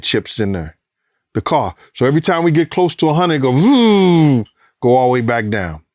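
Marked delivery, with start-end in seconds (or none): 3.5-4.5 imitated engine rev.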